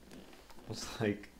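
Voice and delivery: ordinary delivery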